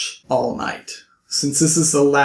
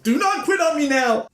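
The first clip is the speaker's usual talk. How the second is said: high, excited pep call; pitched-up cheer to the audience not to quit; rallying cheer; 12 semitones higher than usual. Real, not acted. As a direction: rouse the viewers to keep going. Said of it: "We are almost done, people".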